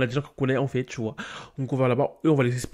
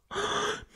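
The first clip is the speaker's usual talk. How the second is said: gasp; 7 dB quieter than usual. Real, not acted. Shocked at the Sims scene.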